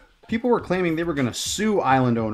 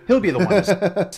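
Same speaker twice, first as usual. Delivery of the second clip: breaking into a laugh mid-sentence; can't get through it for laughing; 5 semitones higher, 4 dB louder than usual. Real, not acted. Blooper, cracks up mid-line.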